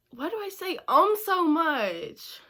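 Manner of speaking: high, loud self-mocking outburst; amused disbelief. Real, not acted. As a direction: exclaim at yourself in mock dismay. Laughing at her own filler words.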